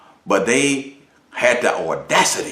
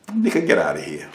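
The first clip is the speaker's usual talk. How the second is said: softer, higher, throwaway brush-off; curt dismissal; 7 semitones higher, 4 dB quieter, faster than usual. Retold or imitated. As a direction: wave him off in one breath. God dismissing the sinner.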